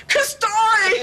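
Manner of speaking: loud high call across the ashram; yelling a name. Retold or imitated. Swami calling Kastori across the ashram, loud shout. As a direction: shout the name to summon him. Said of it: "he yells".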